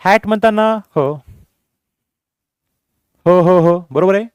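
ordinary delivery

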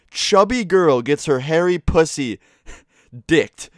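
slow, cracking up mid-line; laughing while reading. Real, not acted. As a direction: read it slowly, bursting into laughter. Reading porn title while laughing.

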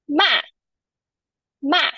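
MA high with a sharp fall; fourth tone. Shown as a demonstration. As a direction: drop MA firmly from high pitch. Teacher demonstrating fourth tone.

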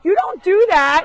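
high, loud telling-off of a naughty goat; sharp mock-stern scolding; scolding shout. Real, not acted. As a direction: yell at the goat to stop it.

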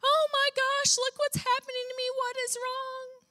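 high, whiny mock complaint; hammed-up high whine; mock moaning. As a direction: play a griping complainer. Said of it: "sit and moan and complain and gripe".

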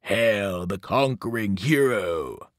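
slow, menacing evil voice; lightly voiced sinister hail. A narrator's reading. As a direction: intone it like a dark god gloating. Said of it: "The evil voice began to laugh".

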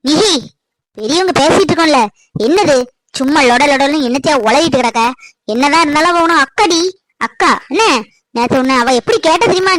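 put-on loud, sharp scolding of a child. Mother scolds Nihi for interrupting the elders, loudly. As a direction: tell the girl off for butting in.

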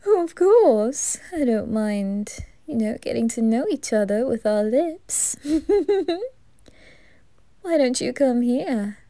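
campy reaper flirting, giggly and teasing; flirty Grell. Acted. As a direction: seduce the listener playfully. Grell flirts with listener throughout.

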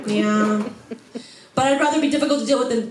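ordinary talk, nothing marked

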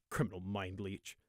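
low-volume grumble under the breath; quiet, under-the-breath jab; muttered insult. A narrator's reading. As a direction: mutter it softly to yourself. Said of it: "caught a mutter of".

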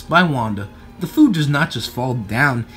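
ordinary delivery, nothing marked

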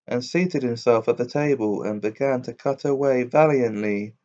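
ordinary delivery, nothing marked

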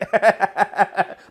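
high loud burst of laughter; howling laugh. Real, not acted. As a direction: crack up hard. Laughing at the situation.

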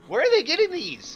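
very high, loud disbelief; exasperated cry. Real, not acted. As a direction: shriek in exasperation. Swarmed by enemies.

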